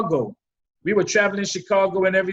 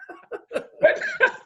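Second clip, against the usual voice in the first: high burst of laughter. Real, not acted. Guest asked how he got the nickname, laughing. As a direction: crack up at the question.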